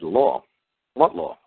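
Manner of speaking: a mock judge scoffing in disbelief; a touch of an indignant judge; incredulous retort.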